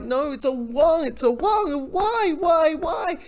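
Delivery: high, loud, climbing disbelief; dismayed protest. Acted. Viewer reacts to villain botching his plan, dismayed protest. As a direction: plead with the screen as it goes wrong.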